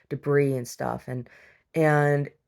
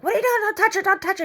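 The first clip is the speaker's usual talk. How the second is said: high, loud, rapid-fire angry yell; angry boyfriend; 6 dB louder, faster than usual. Retold or imitated. Omar yells at a stranger covering her up, acted angry yell. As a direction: imitate an outraged man shouting someone off. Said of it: "like, all angry".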